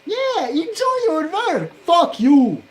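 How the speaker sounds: shrieked very high and loud, mocking then cursing; real rage, screamed at the top of the voice; sarcastic furious scream. Real, not acted. Sarcastic outburst at the work.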